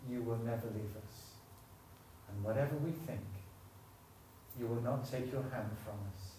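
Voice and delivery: gentle pastoral prayer voice. Acted. Leads closing prayer.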